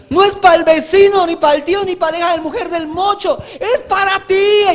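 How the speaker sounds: alto y cada vez más fuerte, arrepiéntete a gritos; clamor creciente. Real, not acted. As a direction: sube hasta gritar arrepiéntete. Clímax del llamado al altar. (predicador clamando a la iglesia, a gritos)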